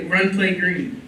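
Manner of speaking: loud, slow emphatic command; coach shouting. Acted. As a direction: drive the call home. Coach urging play call.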